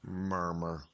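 low, soft mumbled murmur. Shown as a demonstration. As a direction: let the word sound like what it means. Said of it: "Just listen to it. It explains itself".

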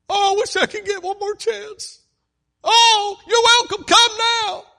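very high, very loud desperate wail; anguished cry. Acted.

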